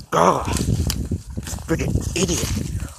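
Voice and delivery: lightly imitated exasperated movie character; a hint of an impression, groan then drawn-out insult; Napoleon Dynamite. Vlogger imitates a film character after someone falls, exasperated impression. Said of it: "that's my impression, even at that, I'm Napoleon Dynamite".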